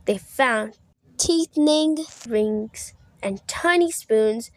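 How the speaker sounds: ordinary delivery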